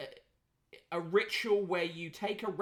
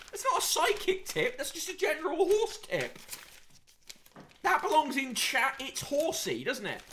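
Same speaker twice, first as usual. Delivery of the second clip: incredulous; 8 semitones higher than usual. Real, not acted. Mocking the horse tip.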